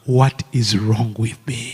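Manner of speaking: slow, low plea to God; retold anguished question. Man secretly asking the Lord about himself, slow and troubled. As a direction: ask it slowly, pitched low, searching. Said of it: "And I asked the Lord".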